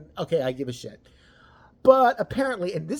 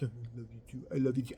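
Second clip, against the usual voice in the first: mock tender boyfriend; 6 semitones lower, 8 dB quieter, faster than usual. Imagined boyfriend.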